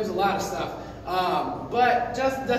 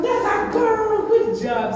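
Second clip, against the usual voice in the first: very high, slow, loud praise; high-pitched dog praise; 6 dB louder, slower than usual. Real, not acted. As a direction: gush over the dog in a squeaky voice. Praising dog after recall.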